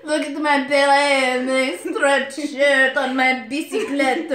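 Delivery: laughing, loud mock French; French accent. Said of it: "A French accent".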